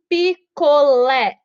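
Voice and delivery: picolé drawn out, pi-co-LÉ; syllable-by-syllable stress demo. Shown as a demonstration. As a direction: slowly split picolé, landing hard on lé. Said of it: "oxítona".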